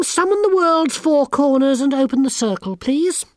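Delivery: put-on high, smug and sneering girl; haughty schoolgirl. A narrator's reading. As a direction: look down your nose at everyone. Anagramma bosses the coven.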